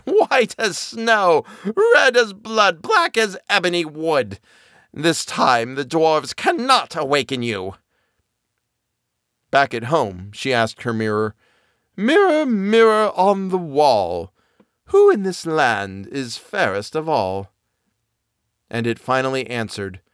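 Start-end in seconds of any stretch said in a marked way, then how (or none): 0.0-7.7 high, slow triumphant gloat; gloating witch queen. Acted. 11.9-17.5 high, slow queen calling her mirror; imperious queen, drawn out. Acted.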